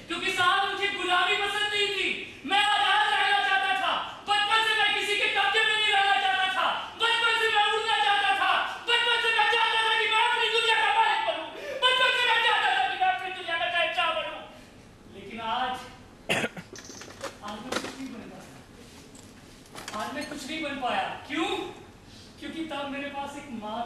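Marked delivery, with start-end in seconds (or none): 0.1-14.4 high, driving speech building to a rush; impassioned declaration. Acted. Man declaring his childhood dream of freedom, impassioned. 15.1-21.1 low, hushed, slowing admission of failure; dejected. Acted. Man admitting he became nothing, dejected.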